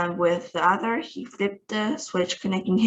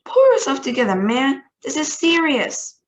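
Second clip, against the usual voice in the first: loud, higher stern rebuke; stern scolding; 6 semitones higher, 5 dB louder than usual. Acted. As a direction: scold him hard. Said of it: "snapped ground control".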